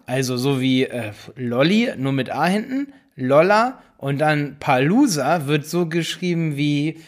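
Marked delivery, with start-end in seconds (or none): none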